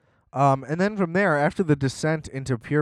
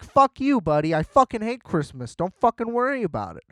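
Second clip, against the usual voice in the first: put-on angry girl; 8 semitones higher than usual. Girl snapping at the guy who's bugging her about Christmas, angry. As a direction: bite his head off with hostility. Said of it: "And she's like".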